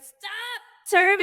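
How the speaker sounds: high-pitched mock cry of alarm; mock panic. Humans balking at rocket turbulence, mock panic. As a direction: squeal it in exaggerated panic.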